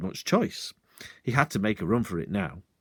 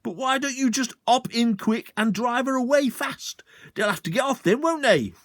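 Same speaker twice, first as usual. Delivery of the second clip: much higher, falsely helpful; sly suggestion; 12 semitones higher than usual. Acted. Sergeant slyly urging the landowner to drive off. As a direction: bait him into the car.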